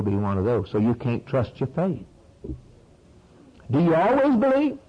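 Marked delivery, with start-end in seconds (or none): 3.7-4.8 a preacher declaring his claim for the whole world to hear; preaching at full force. Acted. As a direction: hammer it home from the pulpit.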